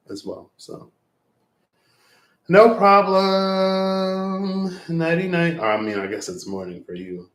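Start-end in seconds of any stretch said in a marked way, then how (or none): 2.5-5.6 very slow, much higher "no problem"; drawn-out sing-song. Real, not acted. Closing the stream for viewers, slow high sing-song. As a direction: stretch it out lazily. Signing off playfully.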